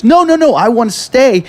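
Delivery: high-pitched, excited protest; playful high impression of someone pleading to stay; imitated eager insistence. Acts woman eager to stay drinking.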